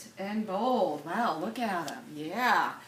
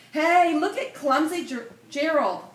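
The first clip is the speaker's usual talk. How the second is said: loud, higher sneering taunt; 5 semitones higher, 6 dB louder than usual. A narrator's reading. Animals jeering at the clumsy giraffe, loud sneer. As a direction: jeer at him like a mocking crowd. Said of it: "the animals all sneered".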